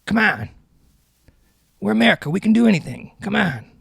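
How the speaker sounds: high-pitched, hammed-up Biden impression; terrible Joe Biden. Podcast host rallying America as Biden, in a terrible impression. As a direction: badly imitate Biden, pitched well up. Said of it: "It's my terrible Joe Biden".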